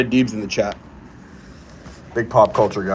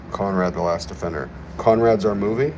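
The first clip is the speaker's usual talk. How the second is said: ordinary talk, nothing marked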